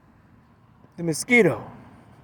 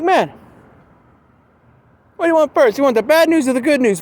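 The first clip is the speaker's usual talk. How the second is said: higher pitched, quicker imitation of others; raised mock voice; 3 semitones higher, faster than usual. Others mimicking his line, higher and quicker. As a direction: pitch it up and hurry the question. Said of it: "They're like".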